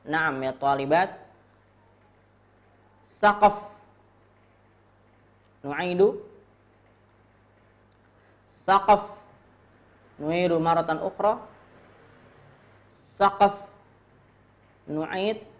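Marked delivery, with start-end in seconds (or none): 3.1-3.7 high, loud model word; drill call. Shown as a demonstration. Teacher modelling a word for students, loud drill call. 8.5-9.2 high, loud model word; drill call. Shown as a demonstration. Teacher modelling a word for students, loud drill call. 12.9-13.5 high, loud model word; drill call. Shown as a demonstration. Teacher modelling a word for students, loud drill call.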